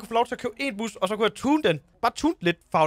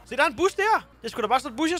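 excited; 7 semitones higher than usual. Real, not acted. Spots a bus in game.

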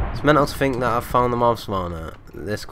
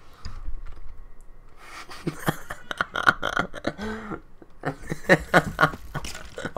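breathless giggle, pitched up high; high wheezy laugh; 10 semitones higher than usual. Real, not acted. Gamer laughing at poo-looking coal, wheezy giggle. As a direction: crack up at the silly joke.

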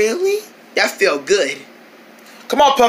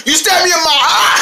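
laughing mock yell; 10 semitones higher, 3 dB louder than usual. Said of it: "He said".

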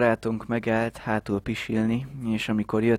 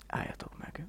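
whisper; 15 dB quieter than usual. Retold or imitated. Hiding, spotting Mihály.

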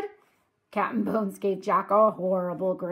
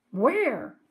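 sharp, higher pirate question; demanding pirate; 5 semitones higher, 4 dB louder than usual. Acted. Captain Bones hears of treasure, demanding.

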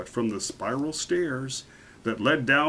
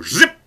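sudden high, loud zip; arrow zip; 8 semitones higher, 10 dB louder than usual. A narrator's reading. Storyteller as the yeoman looses his arrow, sudden zip. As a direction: fire the word off like an arrow. Arrow sound acted out in narration.

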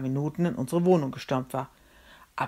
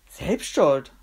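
slightly raised retort; mild defiant call; 4 dB louder than usual. Acted. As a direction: toss back the blame. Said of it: "rief ich".

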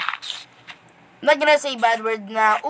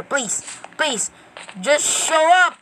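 pleading; 6 semitones higher than usual. Real, not acted. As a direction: plead with the game. Begging the village to appear.

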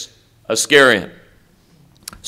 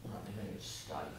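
much softer, muttered jealous dismissal; mock jealous sneer; 6 semitones lower, 31 dB quieter than usual. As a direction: sneer it under your breath like a sore loser. Mocking a jealous classmate.

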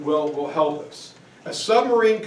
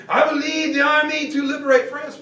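mock grand declaration; 7 semitones higher, faster than usual. French general insisting to Eisenhower, hammed-up French proclamation. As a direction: proclaim it like a liberator. Giraud repeats demand louder.

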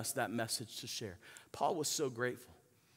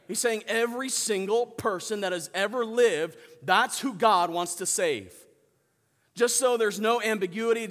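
higher, louder, building fervor; preaching at full force; 6 semitones higher, 7 dB louder than usual. Acted. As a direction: preach it out to the congregation.

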